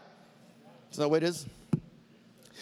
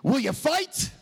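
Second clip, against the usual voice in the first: Wallace rallying troops; 8 semitones higher than usual. Retold or imitated. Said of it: "addressing his band of brothers and asking".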